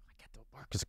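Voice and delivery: playful whispered aside. Real, not acted. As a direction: drop to a breathy secret aside. Joking aside about his dog.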